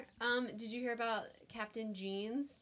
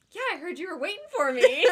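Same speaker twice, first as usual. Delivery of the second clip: smug; 8 semitones higher, 13 dB louder than usual. Retold or imitated. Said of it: "you just turn and look at me and go".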